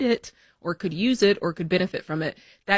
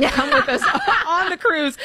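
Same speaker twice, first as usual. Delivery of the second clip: higher, breaking into a laugh; 9 semitones higher than usual. Real, not acted. Host jokes with listeners about paying their way, laughing. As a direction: laugh through the invitation. Joking retraction of the invitation.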